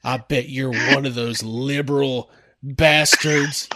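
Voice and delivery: put-on low, slow Southern accent; slow Southern good-old-boy put-down. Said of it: "please read that in a Southern accent".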